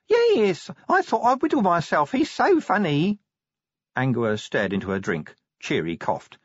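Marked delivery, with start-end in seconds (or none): 0.1-3.2 put-on much higher, giggly girl voice; Tawny, giggly. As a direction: gush like a smitten girl.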